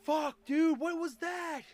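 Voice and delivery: high, loud shocked yell; jump-scared outburst; startled shout. Real, not acted. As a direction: shout it in real alarm. Startled by sudden event in game.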